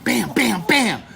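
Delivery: loud, punchy impact noises; high, forceful sound-effect hits; imitated pounding. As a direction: punch out each blow. Imitating dolphins pounding his back.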